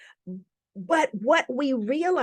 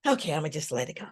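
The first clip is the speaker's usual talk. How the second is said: resigned inner voice; 7 semitones lower, 6 dB quieter, much faster than usual. Retold or imitated. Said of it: "And I decide".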